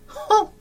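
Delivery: sharp, loud, high angry snort; angry humph. Acted. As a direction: snort it out in rage. Zhou Yu furious at news.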